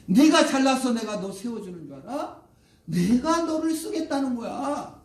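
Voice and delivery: high, loud scolding; angry God. Acted. God tells off reluctant Moses, acted angry outburst. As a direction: rebuke indignantly, stretching the last phrase. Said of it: "화를 내요".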